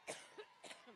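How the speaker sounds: deep hacking cough from the chest; chesty cough. Real, not acted. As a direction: cough it out hard. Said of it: "I get allergic".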